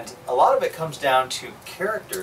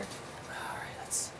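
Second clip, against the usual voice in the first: whispering; 19 dB quieter, much slower than usual. Real, not acted. Quiet aside while the co-host talks.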